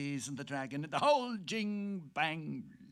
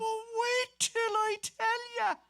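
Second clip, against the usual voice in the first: gleeful; 5 dB louder than usual. Acted. Gleeful gossip opening his tale.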